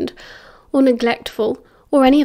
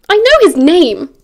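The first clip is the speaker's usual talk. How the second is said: loud, high-pitched retort; annoyed snap; 7 semitones higher, 8 dB louder than usual. A narrator's reading. Sirius annoyed at being told his friend's name, snapping. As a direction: fire it back, irritated. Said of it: "Sirius snapped, getting annoyed now".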